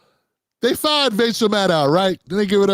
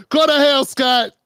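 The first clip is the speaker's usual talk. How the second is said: higher, louder mock yell of go to hell; yelled curse; 5 semitones higher, 4 dB louder than usual. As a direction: bark GO to hell out loud. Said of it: "That's what I said".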